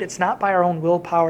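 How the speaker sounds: ordinary delivery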